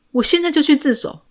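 high, loud burst of resolve; desperate outburst. A narrator's reading. Breaks free to turn himself in. (激动地喊出来)

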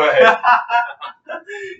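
loud, high burst of laughter; big unrestrained laugh. Real, not acted. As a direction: burst out laughing at the shared joke. Joke about student council.

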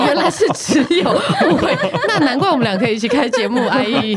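high, loud, laughing. Real, not acted. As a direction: laugh through the words. Laughing at cohost's reply.

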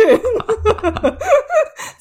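high, loud falling laugh; burst of laughter. Real, not acted. Laughing at the tourists going into the palace without them, real laughter. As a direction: crack up helplessly. Laughing at being stuck outside.

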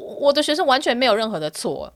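ordinary talk, nothing marked

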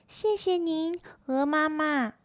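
high tadpole voice; polite little tadpoles. Acted. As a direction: thank her in a small, high-pitched voice.

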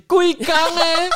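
high, loud, drawn-out mock cry; stretched-out, high-pitched imitation holler; mock catchphrase yell. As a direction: yell it slowly like the meme rant. Quoting the meme's ranting catchphrase.

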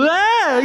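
sharp high scream of alarm; startled high shriek. Acted. Princess shrieks at the ghost threat, high alarmed cry. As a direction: shriek in sudden fright. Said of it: "一声大叫".

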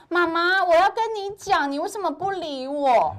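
put-on high, loud child whining; whiny child. Son tugging off mom's earphones, high and whiny. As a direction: plead like a hurt little boy.

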